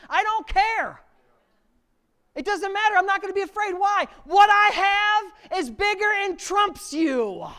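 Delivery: high, loud, defiant shout. Pretend. David facing the giant, high loud defiant shout. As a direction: shout him down, slow and fearless.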